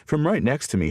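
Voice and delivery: plain reading voice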